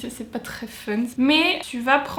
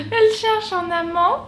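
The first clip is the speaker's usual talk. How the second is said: surprised gasp; 6 semitones higher, 4 dB louder than usual. Real, not acted. Sudden realization about the lyric.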